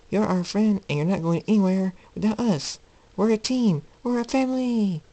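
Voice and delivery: eager friend voice. Acted. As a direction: pitch it way up and declare it. Pima voiced, far higher pitch.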